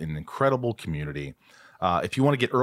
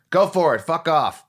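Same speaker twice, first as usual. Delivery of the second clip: loud, higher mock outburst from the agent; mock angry brush-off; 8 semitones higher, 5 dB louder than usual. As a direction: snap it at him like a hurt agent. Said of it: "He goes".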